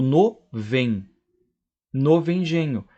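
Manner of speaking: "no vengenho" as novo engenho with the o dropped; elided. Shown as a demonstration. Teacher showing elision, "no vengenho" fused and clearly pronounced. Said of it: "eu digo".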